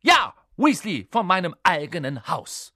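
loud, very high furious scolding; outraged high-pitched tirade; losing her temper. A narrator's reading. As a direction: rage at the pupils as their teacher. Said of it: "verlor sie die Beherrschung".

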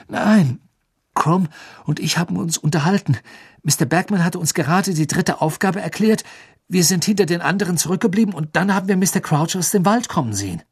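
put-on high, hurried boy's explanation; urgent Harry. Harry answering Dumbledore on the run, high and urgent.

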